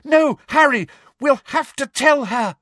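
high, loud desperate plea; cracked-voice shout. A narrator's reading. Hermione urges Harry to tell, shouting desperately. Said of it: "shouted Hermione in a cracked voice".

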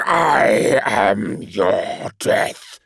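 slow, looming demon voice; demon promise. A narrator's reading. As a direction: promise death, very slowly. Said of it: "the creature promised".

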